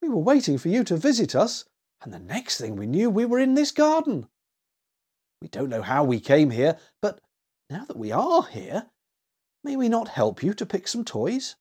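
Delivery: put-on timid child working up to a polite request; shy. A narrator's reading. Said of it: "said Willie shyly".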